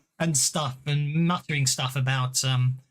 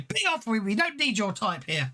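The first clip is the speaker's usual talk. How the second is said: hostile villager; 6 semitones higher than usual. Acted. GM voices hostile townsperson.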